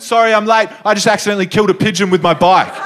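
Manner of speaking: high, loud, rushed blurt; blurted apology. Retold or imitated. Teacher bursting into class late, flustered blurt. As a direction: burst in flustered and spill it fast.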